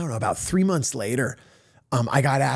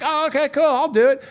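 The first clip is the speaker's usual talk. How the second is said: eager agreement; 10 semitones higher, 6 dB louder than usual. Retold or imitated. Acting out his eager yes.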